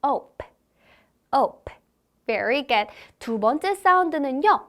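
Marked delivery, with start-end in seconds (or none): 0.0-1.7 ope said slowly and clearly; phonics demo. Shown as a demonstration. Teacher demonstrating ope for children to copy. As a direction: model ope for kids to repeat. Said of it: "한번 다시 해볼게요".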